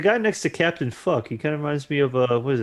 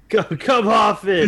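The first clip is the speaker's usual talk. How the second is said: shouting; 6 semitones higher, 6 dB louder, slower than usual. Acted. Riffing on the movie, yelling.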